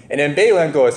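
ordinary talk, nothing marked

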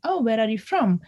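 ordinary talk

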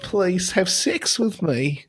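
high, slow plea in mock Yiddish accent; wandering Yiddish accent. Co-host as pleading robot, soft wandering Yiddish accent. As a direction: beg softly in a shaky-grasp Yiddish accent. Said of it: "my accent is going all over the place".

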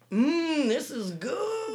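loud, slow fake "mmm, this is good"; savoring delicious food. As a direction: pretend to love the kids' cooking. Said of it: "attempt to".

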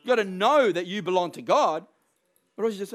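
ordinary talk

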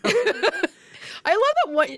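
slow and high through laughter; laughing delight. Real, not acted. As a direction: drawl it out laughing. Laughs while reacting.

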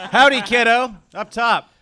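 giddy laugh into a loud howdy. Acted. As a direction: greet the kid laughing, loud and high. Jolly greeting to child.

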